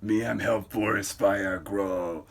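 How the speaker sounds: slow, dim-witted lumbering voice; Bizarro. Acted. Bizarro announcing his fire plan, slow dim brute voice. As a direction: drag each word like a clumsy brute. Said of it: "Oh, it's Bizarro".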